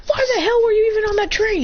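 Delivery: high-pitched indignant demand; acted scolding. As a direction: demand an answer, mock-indignant. Character scolds Boruto.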